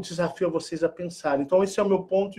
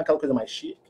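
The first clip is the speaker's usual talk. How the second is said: ordinary delivery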